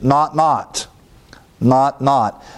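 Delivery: each "not" hit hard and separately; emphatic repetition. Shown as a demonstration. Showing the Greek double negative in English, each "not" stressed. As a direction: pound out "not" four times. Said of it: "It's like saying in the English".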